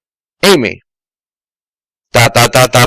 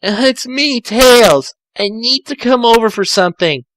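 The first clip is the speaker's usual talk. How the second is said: put-on higher, hesitant boyish voice; a nervous kid on the phone, pitched up; higher Tails voice; 8 semitones higher than usual. Tails calling Amy for help, hesitant higher voice. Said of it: "Tails said".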